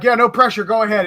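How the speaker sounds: high, loud mock-innocent "no pressure"; teasing sarcasm. Buddies watching him zero a pistol, sarcastic and teasing. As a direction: rib him with a sarcastic grin. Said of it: "And they're like".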